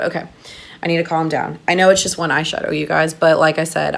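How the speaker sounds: normal talking voice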